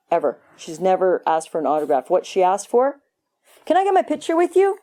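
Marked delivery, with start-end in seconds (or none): 3.7-4.8 high, fast gushing fan voice; mock fan begging for a selfie; eager selfie fan. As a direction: squeal it like a starstruck fan. Mimicking selfie-seeking fan.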